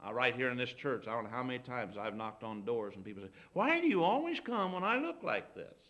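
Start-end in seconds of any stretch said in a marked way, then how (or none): 3.5-5.8 high-pitched imitation of a flustered host; mock exasperated complaint. People answering the preacher's knock, mock exasperated. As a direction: complain in a much higher put-on voice.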